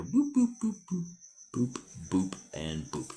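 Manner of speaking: sing-song boop sound effects, falling away; playful boops while dotting the map. Real, not acted. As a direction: mark each dot with a boop. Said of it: "putting little dots here".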